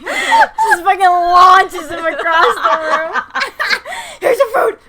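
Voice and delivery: cracking up while telling it; laughing. Real, not acted. Friend laughs retelling the housekeeper's body slam. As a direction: squeeze words out between laughs.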